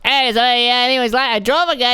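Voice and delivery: high, loud, bubbly chatterbox; chatty driver voice. Retold or imitated. Chatty driver rambling to his passenger, high and loud. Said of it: "He's like".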